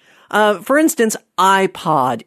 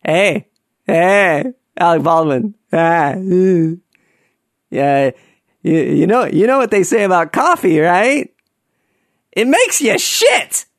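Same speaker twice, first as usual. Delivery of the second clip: cheeky fan voice. Pretend. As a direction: needle the celebrity with the joke. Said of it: "I would love to go up to him and say".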